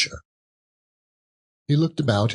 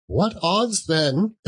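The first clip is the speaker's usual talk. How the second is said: high mocking woman; 10 semitones higher, slower than usual. A narrator's reading.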